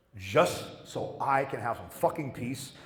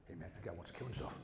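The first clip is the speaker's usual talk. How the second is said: murmuring patients; 6 semitones lower, 18 dB quieter, much faster than usual. Retold or imitated.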